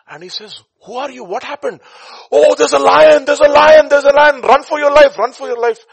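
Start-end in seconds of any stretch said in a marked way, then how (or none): 0.8-1.8 high-pitched, alarmed questions; startled questioning. Acted. 2.3-5.8 high, screamed cries of alarm; panicked warning. Acted. Fleeing people scream about lion.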